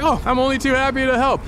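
bright smiling reassurance, rising to a high question. Acted. Cabbie cheerfully reassuring the nervous passengers, smiling. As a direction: put on a cheerful helpful smile. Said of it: "with a smile on your voice".

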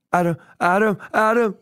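retold panicked calling of a name; scared calling. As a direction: mimic her calling him in real fear. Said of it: "she had this tone in her voice".

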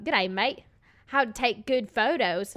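slow, badly faked Australian accent. Quoting her Australian husband, badly faked Australian accent. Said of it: "can't do an Australian accent".

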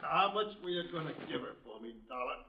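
loud, menacing questioning; angry demand. Acted. Wounded gunman angrily demands to know the price, loud. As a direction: bark the question at her.

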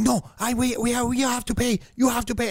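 high-pitched insistent protest; mock a stubborn driver demanding money; high, agitated refusal; insistent taxi driver. Acted argument with taxi driver.

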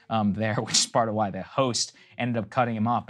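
usual speaking voice

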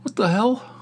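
high disbelieving what the hell; incredulous. Retold or imitated. Reacting to people who can't eat fries, high incredulous. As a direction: pitch it way up in mock disbelief. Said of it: "I'm like".

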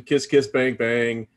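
normal talking voice

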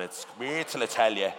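loud, mock-angry Ballymun accent; mother's angry Ballymun accent. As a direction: let the Ballymun out, loudly. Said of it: "Get her angry".

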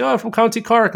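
retold line in a mild Irish accent. Telling how he fooled people on trains, mild Irish accent. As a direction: pass as a Cork man, lightly. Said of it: "I could do the Irish thing".

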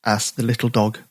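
ordinary delivery, nothing marked

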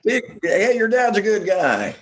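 high, hammed-up PSA pitch; a light cheesy PSA read; mock announcer.

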